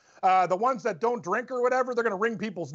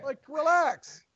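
mock calming plea; 6 semitones higher, slower than usual. Telling brawlers to calm down.

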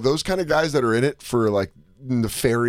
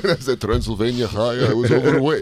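put-on comic Transylvanian vampire accent; Dracula accent. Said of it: "What's with your stupid accent?".

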